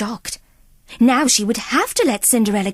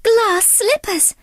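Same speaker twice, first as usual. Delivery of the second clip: much higher, slow, wondering delight; higher, breathless dreamy wonder; enchanted Cinderella; 8 semitones higher than usual. Acted. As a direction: gasp in awe at the slippers.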